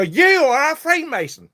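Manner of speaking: high, loud mimic of a ranting anti-mask man; hammed-up imitation of an angry stranger yelling.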